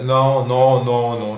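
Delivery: loud, repeated refusal; real exasperation. Real, not acted. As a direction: protest loudly at the failed answer. Rejects the Beatles questions after failing.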